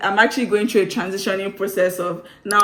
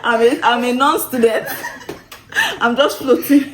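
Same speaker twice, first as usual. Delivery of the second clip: laughing; 5 semitones higher, 4 dB louder than usual. Real, not acted. Joking about being a non-student.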